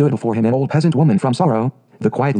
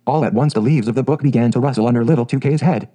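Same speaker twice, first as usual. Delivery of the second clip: ordinary delivery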